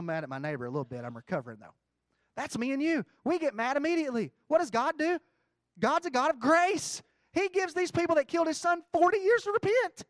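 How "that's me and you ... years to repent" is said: loud, high, ringing preacher's cry; fervent sermon peak; preaching at full force. Acted. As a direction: lift it up and preach it to the back row. Preaching climax.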